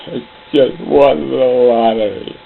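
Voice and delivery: slow, mournful delivery of good news; happy news in a sad voice. Shown as a demonstration. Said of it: "say something happy with a really sad voice".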